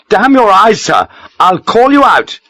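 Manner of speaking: high, loud outraged shout; a young officer howling a duel challenge; furious challenge. A narrator's reading. Lieutenant challenging Sharp to a duel, furious shout.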